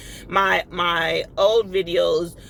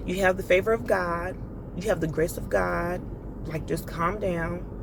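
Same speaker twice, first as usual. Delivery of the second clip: a soft inner voice easing the rage; soothing inner voice; 2 semitones lower, 6 dB quieter than usual. Retold or imitated. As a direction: hush yourself down gently, mildly voiced. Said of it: "a part of me that was like".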